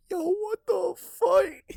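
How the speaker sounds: very high, slow, stretched-out shriek of disbelief; exaggerated disbelief. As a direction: squeal it like you can't believe nobody cares. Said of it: "I'm like".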